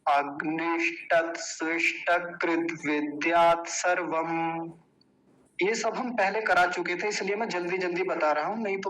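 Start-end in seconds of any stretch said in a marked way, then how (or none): 0.1-4.7 measured syllable-by-syllable Sanskrit recitation; careful teaching recitation, last word drawn out; slow, unhurried. Shown as a demonstration. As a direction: take the long compound slowly and evenly. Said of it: "फिर से देखिए आराम से".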